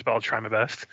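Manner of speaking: ordinary talk, nothing marked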